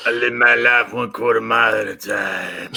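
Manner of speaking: put-on action hero voice; Dom. Quoting a car movie to amuse friend, mock Dom voice. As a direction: deliver it as a mock movie tough guy. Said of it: "All right, Dom".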